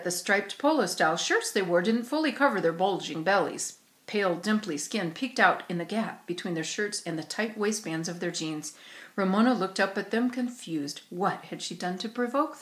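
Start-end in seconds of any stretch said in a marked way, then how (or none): none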